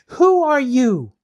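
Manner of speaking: high, loud, slow challenge; loud, slow demanding question. Acted. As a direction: demand an answer. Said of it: "Dorothy demanded".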